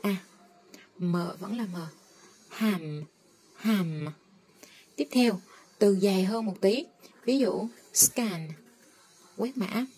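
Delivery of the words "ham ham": Hàm said as ham with a short a, low. Shown as a demonstration. Teacher modelling ham for learners. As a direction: keep the a in Hàm short and flat. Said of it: "A thường là âm ngắn".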